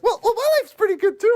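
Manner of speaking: high, loud-ish comic squeal; squeaky mock voice. Friend chiming in on the suicide tape, squeaky mock voice. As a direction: pipe up very high, amused.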